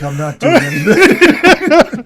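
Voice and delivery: mock lazy whine. Friend mocks a lazy contestant, slow laughing whine. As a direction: play the lazy slob, dragging and amused.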